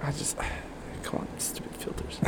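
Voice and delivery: whispering. Real, not acted. Muttering at filter.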